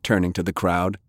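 plain reading voice

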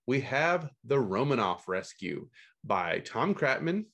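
ordinary talk

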